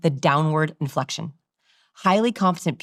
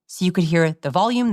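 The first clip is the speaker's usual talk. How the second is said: ordinary talk, nothing marked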